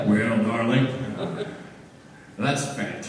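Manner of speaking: a hint of a folksy old man; Wilford Brimley. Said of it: "I'll sound like Wilford Brimley".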